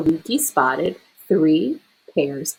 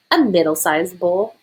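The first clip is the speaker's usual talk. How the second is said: plain reading voice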